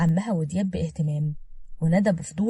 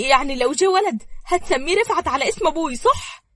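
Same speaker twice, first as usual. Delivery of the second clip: high, loud eager question; thrilled, high-pitched pleading for a yes; overjoyed; 9 semitones higher, 6 dB louder than usual. A narrator's reading. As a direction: ask with bubbling hope, lifting right up. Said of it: "وحضنتها بفرحة".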